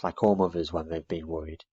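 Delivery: plain reading voice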